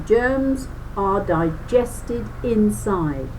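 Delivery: usual speaking voice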